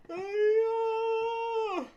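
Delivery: very high, long drawn-out call; distant yelling. Retold or imitated. Storyteller imitates lost friend yelling in the distance, high drawn-out call. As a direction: imitate a far-off cry, stretching the name. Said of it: "yelling in the distance".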